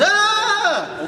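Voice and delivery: high, loud demo yell; sample scream for the audience. Shown as a demonstration. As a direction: show the crowd how to scream. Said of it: "you're just gonna scream".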